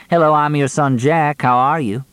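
high-pitched mock cheery introduction; mocking greeting. A narrator's reading. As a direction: throw the greeting back at him, voice lifted. Mock quote thrown back at him.

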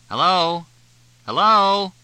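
loud, high call through a door. A narrator's reading. Fletch calling into the bungalow over the din, loud. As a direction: call into the noisy house.

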